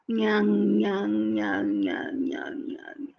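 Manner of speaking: soft nasal munching, fading away; "nyang" munching sound effect; buffalo chewing noise. A narrator's reading. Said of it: "It's chewing some grass".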